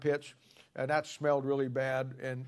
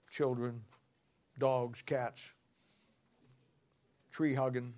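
ordinary talk

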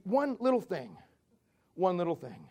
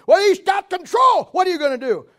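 alarmed mock shout; 10 semitones higher, 12 dB louder than usual. Panicky angel demanding God act, shouted. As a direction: shout it in mock panic, then ease slightly.

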